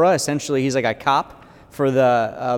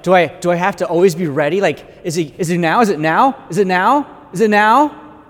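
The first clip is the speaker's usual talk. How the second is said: mounting anxiety; 3 semitones higher, 3 dB louder than usual. Acted. Anxious repeated question, mounting.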